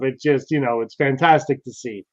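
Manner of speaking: ordinary talk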